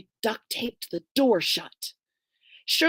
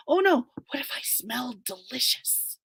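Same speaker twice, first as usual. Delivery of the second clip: ordinary delivery, nothing marked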